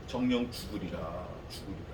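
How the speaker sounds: low, hushed, grave pronouncement; solemn low verdict. Acted. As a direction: intone the death sentence darkly. Solemn divine verdict, whispered low.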